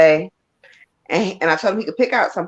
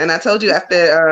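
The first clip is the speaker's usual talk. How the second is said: normal talking voice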